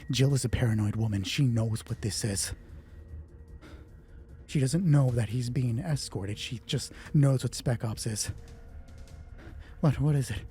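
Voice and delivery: usual speaking voice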